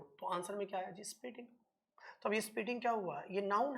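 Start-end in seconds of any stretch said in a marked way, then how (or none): none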